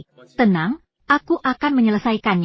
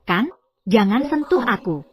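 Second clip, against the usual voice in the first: ordinary talk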